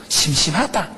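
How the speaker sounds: ordinary talk, nothing marked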